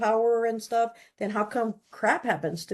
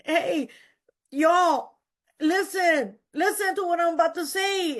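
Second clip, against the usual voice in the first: high, slow tearful plea; pretending to cry as she tells it; mock crying; 8 semitones higher than usual. New member crying at the meeting, high and slow. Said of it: "crying like this".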